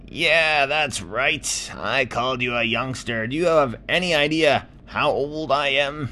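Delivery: put-on high, loud grumpy old clam; talking clam. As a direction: shout up like a cranky old neighbour. Clam voiced.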